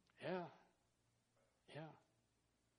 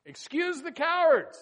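much higher, louder, slow cry; preaching proclamation; 11 semitones higher, 13 dB louder, slower than usual. Acted. Preacher dismisses the cowards from battle, loud and slow. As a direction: ring it out slowly.

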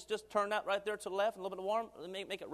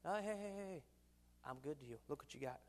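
gentle God; 6 semitones lower, 12 dB quieter than usual. Retold or imitated. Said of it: "God wanted to remind them".

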